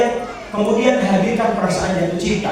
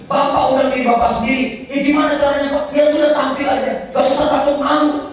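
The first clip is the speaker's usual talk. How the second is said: pitched way up, brisk and breezy coaching; lively acted dialogue; 9 semitones higher than usual. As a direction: toss off easy answers with high energy. (dialog diperankan dengan nada tinggi dan bersemangat)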